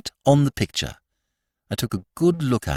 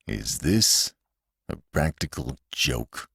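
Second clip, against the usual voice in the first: low, slow and much softer, freezing; icy cold; 9 semitones lower, 7 dB quieter, slower than usual. Acted. Uncle to Corky about the portrait, ice cold. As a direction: chill the room with each word. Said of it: "set about sixteen drafts cutting through".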